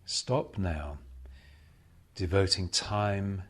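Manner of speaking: ordinary talk, nothing marked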